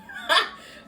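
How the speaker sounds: jittery laugh at the sting; high nervous laugh. Real, not acted. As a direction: squeal of laughter. Laughing at burning lips.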